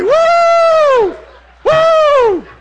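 high, loud drawn-out "woo"; long falsetto victory whoop; exuberant whoop. Acted. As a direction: whoop it up like a show-off. Acting out bragging walk on water.

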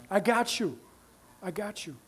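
almost whispered comfort, unhurried; hushed reassurance. Acted. God comforting someone waiting for a spouse, hushed and calm. God answering worried parent.

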